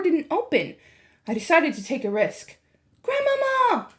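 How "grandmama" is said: high calling shout; shouted loudly. A narrator's reading. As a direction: call through the door. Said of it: "I shouted as loudly as I possibly could".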